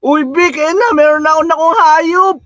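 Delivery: high, thrilled announcement; bursting to show off the new animal; excited. Real, not acted. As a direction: pitch shooting way up with delight. Found his first animal.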